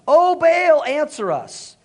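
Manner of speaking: loud, high imploring shout; narrated shout of invocation. A narrator's reading. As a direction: cry out to a god.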